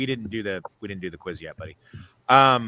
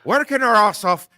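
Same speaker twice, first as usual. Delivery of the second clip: high, loud Irish scolding; out-of-breath Irish grandfather; 11 semitones higher, 7 dB louder than usual. Retold or imitated. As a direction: berate like a winded Irish grandad. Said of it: "that's how my grandfather sounded".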